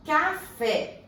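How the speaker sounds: "Café" high, in two separate beats ca, fé; "Café" sounded out high for a class; split into syllables. Shown as a demonstration. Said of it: "vamos separar".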